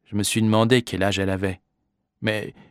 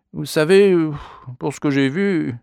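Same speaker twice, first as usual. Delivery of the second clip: higher, halting evasive protest; flustered regret; 5 semitones higher, 4 dB louder than usual. A narrator's reading. Father backing away from police testimony, flustered regret. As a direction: stumble through excuses, breaking off. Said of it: "qui commençait à tout regretter".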